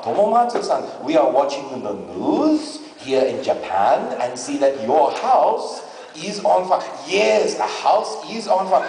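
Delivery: put-on deliberate, polite Japanese-accented phone voice; comic Japanese accent, measured and courteous; calm Japanese accent. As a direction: play an unflustered uncle with a Japanese accent. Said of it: "Japanese family that gets very calm, cool, and collected".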